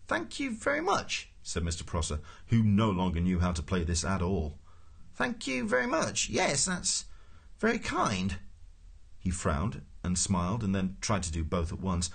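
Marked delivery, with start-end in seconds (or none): 0.1-1.3 high, slow bewildered official; polite thanks in a high, lost voice; baffled politeness. A narrator's reading. Prosser no longer knows how to play this, baffled high politeness. 5.1-8.4 high, bewildered thanks; baffled politeness. A narrator's reading. Prosser humours Ford without understanding, high baffled politeness.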